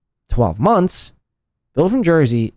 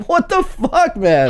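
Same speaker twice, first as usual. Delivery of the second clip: laughing disbelief; slower than usual. Real, not acted. Laughing at misread name.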